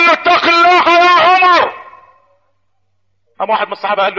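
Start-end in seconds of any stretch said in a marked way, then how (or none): 0.1-1.7 high, forceful drawn-out admonition; blunt bold challenge. Retold or imitated. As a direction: confront the ruler head-on, loud and slow. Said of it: "انت بتخاطب امير المؤمنين باللهجة دي ليه". (تحذير جريء بصوت عالي وممدود)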